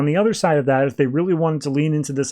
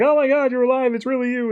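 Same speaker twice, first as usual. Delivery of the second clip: much higher, joyful cry of disbelief; overjoyed Booster; 11 semitones higher than usual. Retold or imitated. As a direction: squeal it like he's seen a ghost he loves. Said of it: "Booster's so happy, he's like".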